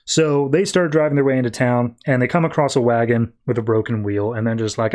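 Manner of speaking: usual speaking voice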